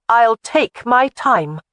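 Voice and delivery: slow, loud, defiant drawl. A narrator's reading. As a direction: drag it out to defy him. Jamie defies the laird.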